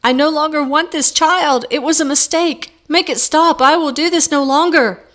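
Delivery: high, loud panicked outcry in labor; crying out. A narrator's reading. Woman in labor crying out to Beetle, high and loud. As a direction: cry out to be done with it. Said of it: "She grabbed Beetle's arm and cried".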